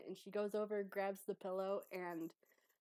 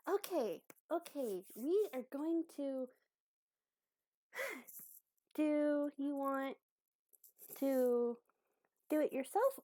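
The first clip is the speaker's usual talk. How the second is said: higher, louder, deliberate helper voice; encouraging helper character; 7 semitones higher, 6 dB louder than usual. Acted. Abby offers to show Croak how to sew, encouraging. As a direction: think it out, then offer keenly.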